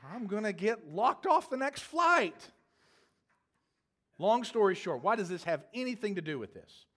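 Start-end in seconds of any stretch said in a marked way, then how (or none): 0.0-2.5 much higher and louder, slow, with a laugh; panicked protest. Retold or imitated. Kendall begs the teller not to name her, half-laughing panic. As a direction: plead in a half-laughing panic, stretching the words. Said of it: "Kendall turns around and says".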